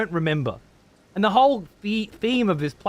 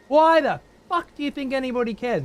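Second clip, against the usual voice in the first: exasperated yell; 10 semitones higher than usual. Real, not acted. Streamer yelling at a chatter who spoils the game, exasperated. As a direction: blow up at him in frustration. Streamer ranting at chat viewer.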